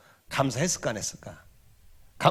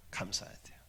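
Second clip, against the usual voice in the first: whisper; 17 dB quieter than usual. Real, not acted. Whispered aside to newlyweds.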